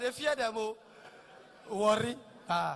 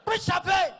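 mock party yell, higher and louder; shouted call; 8 semitones higher, 6 dB louder than usual. Show-off guest yelling at the party. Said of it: "they shout".

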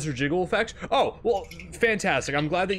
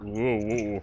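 normal talking voice

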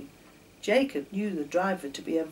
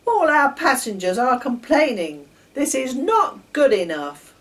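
loud, higher stern official; louder, scolding station master; 4 semitones higher, 9 dB louder than usual. A narrator's reading. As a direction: demand an answer angrily.

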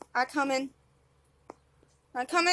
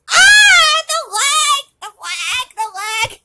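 high loud cry of frustration; frustrated yell; 7 semitones higher, 7 dB louder than usual. Real, not acted. Gamer yelling at the lag, loud cry. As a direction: yell at the lagging game.

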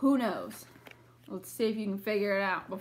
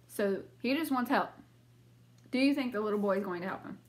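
ordinary delivery

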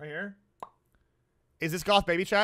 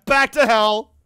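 mock battle cry; 3 semitones higher, 10 dB louder, slower than usual. Streamer returns to grim beige-mom video, mock-epic shout. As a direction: bellow it grandly after a laugh.

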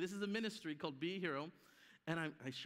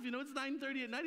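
usual speaking voice

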